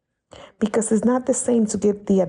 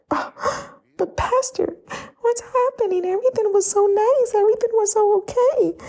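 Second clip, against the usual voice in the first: much higher, whiny mock-bewildered voice; confused believer; 13 semitones higher than usual. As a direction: play a flustered follower pleading with the pastor. Imitating confused church member.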